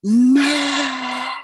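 one last cry of terror; final scream. Retold or imitated. Patient's last scream before falling back, very high. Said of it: "And then she's like".

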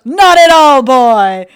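loud, high, drawn-out cartoon bellow; SpongeBob character impression. Friend re-enacting a SpongeBob scene, booming and cartoonish. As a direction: belt it out like a cartoon fellow. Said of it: "And then he goes".

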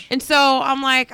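usual speaking voice

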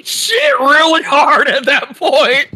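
high laughing through words. Real, not acted. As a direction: laugh hard while telling it. Laughing while telling story.

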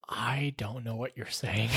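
very soft, low and slow, sheepish; helpless confession. Retold or imitated. Store worker confesses to a customer, helplessly quiet. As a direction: admit it slowly under your breath.

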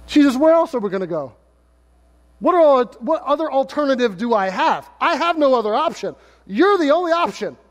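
rising, earnest plea to Jesus; Peter pleading. Retold or imitated.

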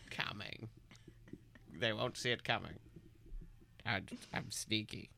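whispered scheming aside; villain whisper. Acted. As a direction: hiss it like a sneaky villain.

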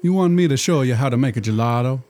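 put-on thick comic Italian accent; hammed-up Italian genie. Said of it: "poor attempt at an Italian accent".

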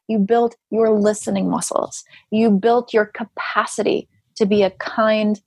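usual speaking voice

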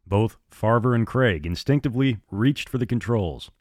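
ordinary delivery

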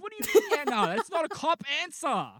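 high, loud mock outrage; exasperated high yell; outraged rebuke. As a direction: explode at the useless colleague. Outraged detective rebukes officer.

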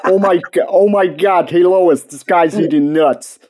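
hammed-up Peter Griffin impression. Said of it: "You're like Peter Griffin".

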